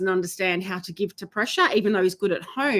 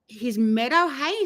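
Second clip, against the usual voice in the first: normal talking voice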